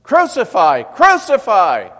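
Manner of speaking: the priests roaring for death; chief priests shouting. Acted. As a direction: yell it as a furious mob. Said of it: "they shouted".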